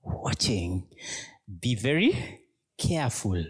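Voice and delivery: slow, measured warning; mild menace. Acted. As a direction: warn them slowly, with a touch of threat. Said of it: "as a sense of threat".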